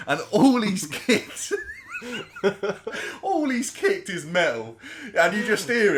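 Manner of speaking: slow words, breaking into laughter; fighting back laughs as you tell it; laughing while telling. Real, not acted. Laughing through the story.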